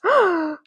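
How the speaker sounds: high, loud acted gasp; sharp theatrical intake of breath; principal's hammed-up gasp; shocked gasp. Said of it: "he gasps".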